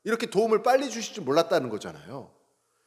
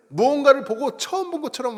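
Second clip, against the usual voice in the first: mock amazement; 7 semitones higher than usual. Shown as a demonstration. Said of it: "감탄사가 뭘까요".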